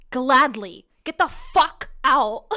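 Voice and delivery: very loud, high acted shout; furious send-off. Woman kicking date out for good, triumphant shout. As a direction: yell it with relish, near laughter.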